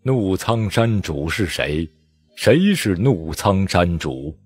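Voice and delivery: very slow, drawn-out, solemn; a far-off voice echoing; slow, desolate calling from afar; slow distant voice. Acted. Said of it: "低沉的声音，悠悠的道".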